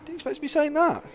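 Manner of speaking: can't believe it, pleased; delighted surprise. Real, not acted. Commentator reacts to unexpected points finish, surprised delight. Surprised joy at Baggy's move.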